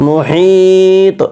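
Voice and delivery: "মুহিত" with the ee held long and high, ending on an echoed t; recitation-style "মুহিত", very long level ee; long stretched vowel. Shown as a demonstration. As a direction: draw out "হি" in "মুহিত", then bounce the final "ত". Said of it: "এই যে ত sound হচ্ছে".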